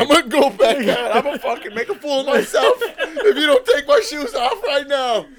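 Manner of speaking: high, loud mock threat; Sammy impression. Podcaster mimicking friend threatening to go back out, loud whiny outburst. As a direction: throw a hammed-up tantrum, loud and high. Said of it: "a dead-on impression of him".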